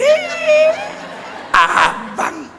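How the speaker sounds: squealed high coy greeting; coy wife. Retold or imitated. Wife coyly greeting her husband at the door. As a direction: squeal it girlishly.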